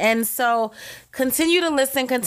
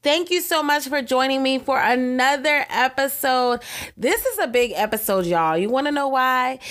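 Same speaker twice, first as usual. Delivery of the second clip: usual speaking voice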